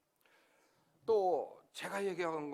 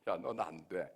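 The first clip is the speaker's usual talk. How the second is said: a lower, blunt voice of God; a preacher voices God turning him down, lower and curt; 10 semitones lower than usual. Retold or imitated. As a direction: hand down a flat refusal from above. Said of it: "하나님이 뭐라고 그러시는지 알아요?".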